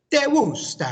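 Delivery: "¿Te gusta?" high and loud, rising; teacher showing a question tune. Shown as a demonstration. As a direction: swoop up hard on the question. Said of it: "our question goes like this".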